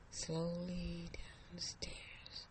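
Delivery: slow hushed whisper, creeping; suspenseful whisper. A narrator's reading. Narrator tells her creeping down the stairs, slow whisper. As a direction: breathe the words out slowly like sneaking down stairs.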